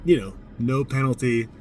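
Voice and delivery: ordinary delivery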